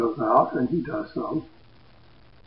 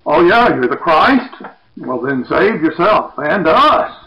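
loud sarcastic jeer, slower on the end; insulting sneer; 4 semitones higher, 9 dB louder than usual. Retold or imitated. Crucified criminal insulting Jesus beside him, loud sarcastic jeer. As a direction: spit it out with scorn. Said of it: "insults him".

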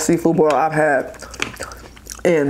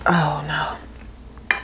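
whispered dismay; 2 semitones lower, 7 dB quieter than usual. Real, not acted. Food dropped, dismay.

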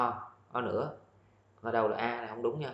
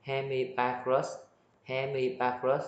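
"Hemibagrus" spelled out slowly, syllable by syllable; slow echo of the dictionary reading of "Hemibagrus"; slow careful echo; slower than usual. Shown as a demonstration. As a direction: sound out "Hemibagrus" slowly, rising at the end. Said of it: "Đọc sao cũng vậy ha".

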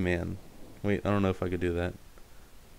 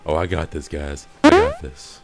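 mocking imitation; 4 semitones lower, 5 dB louder than usual. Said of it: "He's like".

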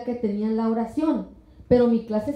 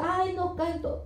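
shy protest; 7 semitones higher, 6 dB quieter than usual. Pretend. Shy woman answering the Lord.

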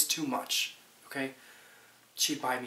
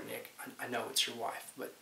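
ordinary talk

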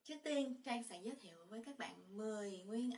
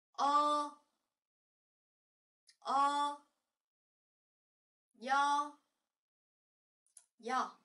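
much louder, high, drawn-out vowels; loud review drill; 4 semitones higher, 10 dB louder, much slower than usual. Shown as a demonstration. Teacher reviews all vowels with the class, loudly. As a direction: call each syllable out for the class to repeat. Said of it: "bây giờ chúng ta đọc lại từ đầu nha".

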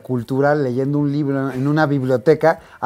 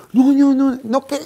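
high-pitched, flustered no no no; panicked denial; 8 semitones higher than usual. Retold or imitated. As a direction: imitate a scared valet backing off. Valet panics when confronted. (el valet asustado niega todo con voz aguda)

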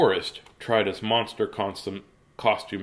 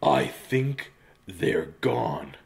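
slow hushed line; quiet, slow; 6 semitones lower, 6 dB quieter, much slower than usual. Acted. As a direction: hush it, very slowly. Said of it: "said Bugley".

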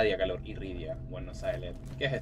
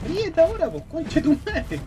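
high gloating taunt; 12 semitones higher than usual. Real, not acted. Gamer to the boss after a hit, high gloating taunt.

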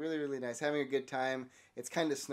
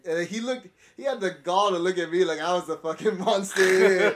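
laughing rant; 4 semitones higher, 9 dB louder than usual. Real, not acted. Ranting, laughing about rude stranger.

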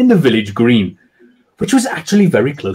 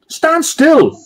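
high shout; 13 semitones higher than usual. A narrator's reading. Sergeant shouting at the creeping socks, high shout. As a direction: order it, much higher. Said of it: "he shouted again".

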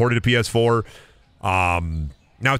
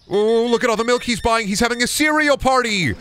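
high-pitched hammed-up wonder; mock excited commentary; 10 semitones higher than usual. Shopkeeper watches a customer buy milk, mock excitement. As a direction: gush over a customer in a high voice.

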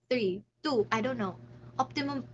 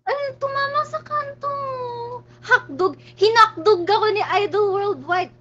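higher, louder pained whine; whining in pain; 9 semitones higher, 8 dB louder than usual. Real, not acted. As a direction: complain through the sting. Still hurting after hitting hand.

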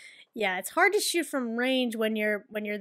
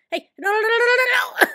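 loud, high, rapid-fire no-no-no; real gamer panic; alarmed protest; 11 semitones higher, 7 dB louder than usual. Real, not acted. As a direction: yell it in a panic at the play. Panics at play in game.

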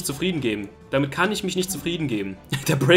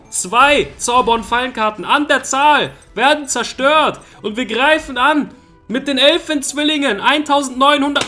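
rising louder, higher, more dramatic; dramatic build-up; 8 semitones higher, 5 dB louder than usual. Real, not acted. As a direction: build it up like an epic attack call. Dramatic card announcement.

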